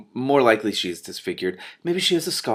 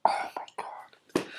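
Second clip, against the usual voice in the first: slow whisper near tears; tearful slow whisper; crying whisper; 8 dB quieter, much slower than usual. Real, not acted. Reader overwhelmed.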